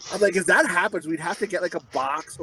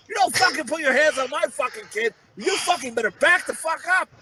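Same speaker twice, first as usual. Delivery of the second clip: high, heated rant at no extra volume; retold angry yell; 8 semitones higher than usual. Parent warning off a stranger who grabbed the kid, angry yell. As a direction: re-enact a furious warning, pitched up. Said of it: "I think I yelled".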